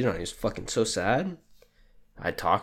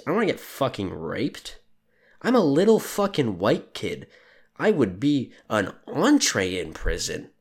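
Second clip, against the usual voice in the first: normal talking voice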